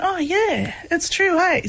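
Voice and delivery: re-enacted dawning realisation, pitched high; light-bulb realisation. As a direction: act out the penny dropping. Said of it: "a bit of a light bulb moment".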